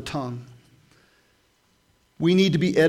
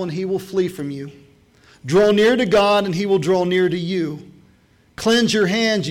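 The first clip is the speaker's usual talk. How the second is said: much higher, louder preached scripture; fiery preaching; 4 semitones higher, 7 dB louder than usual. Acted. Pastor preaching James to the church, at full force. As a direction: thunder the verses at the congregation.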